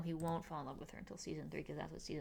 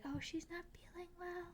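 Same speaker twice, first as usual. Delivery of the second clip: whisper; 12 semitones higher, 9 dB quieter than usual. Retold or imitated. Cousin voiced in retold scene.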